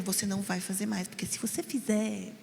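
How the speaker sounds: put-on soft but firm, meant only for the child; firm hushed warning. Shown as a demonstration. Mother quietly warning her son in public, firm and hushed. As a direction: keep it low and steady so nobody else hears. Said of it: "fala pra ele bem firme".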